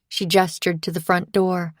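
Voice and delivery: plain reading voice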